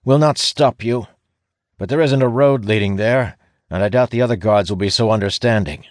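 high, grudging guard voice; scowling guard. A narrator's reading. Guard reluctantly letting the ranger pass, with a scowl. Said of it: "he says with a scowl".

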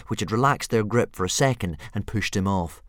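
ordinary delivery, nothing marked

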